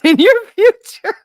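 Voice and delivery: ordinary talk, nothing marked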